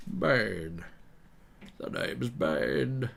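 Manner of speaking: slow, put-on villain voice; Bane. Villain introducing himself on request, Bane impression. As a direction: draw it out as a film villain. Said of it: "asked me to do Bane".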